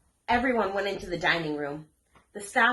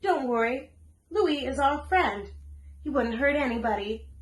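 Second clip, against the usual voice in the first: reassuring; 7 semitones higher than usual. A narrator's reading. Tiana reassures frightened dog.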